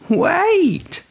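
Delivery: very high squeak; high little mouse cry. A narrator's reading. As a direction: squeak it urgently like a tiny mouse. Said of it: "said the mouse".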